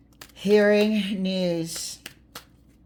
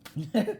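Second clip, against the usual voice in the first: short amused laugh; helpless little chuckle; real laugh; 3 semitones higher than usual. Real, not acted. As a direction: let a brief laugh slip out. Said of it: "I'm laughing at the way".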